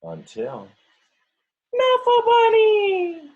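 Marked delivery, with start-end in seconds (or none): 1.7-3.3 put-on high, slow, loud child's shout; toddler shout. Teacher voicing Trixie's first words, shouted high. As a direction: cry out the toy's name joyfully. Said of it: "the first words Trixie ever said".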